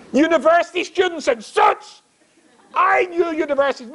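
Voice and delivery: high-pitched disbelief; incredulous. Acted. Rabbi marvels at smartly dressed students, incredulous. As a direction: exclaim it in comic amazement.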